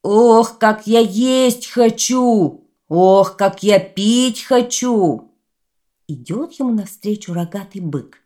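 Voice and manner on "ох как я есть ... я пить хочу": slow, loud, drawn-out bellow. Acted. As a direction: roar it like a greedy giant. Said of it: "Идёт по улице и орёт".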